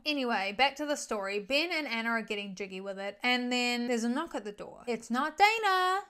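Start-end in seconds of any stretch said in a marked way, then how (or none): 4.9-6.0 higher, louder, slow reveal; dramatic reveal. Real, not acted. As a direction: land the twist big and slow.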